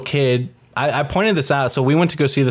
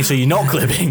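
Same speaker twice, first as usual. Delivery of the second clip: mock Australian. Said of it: "But it's Australian".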